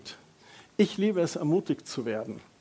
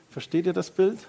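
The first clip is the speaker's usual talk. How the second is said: ordinary talk, nothing marked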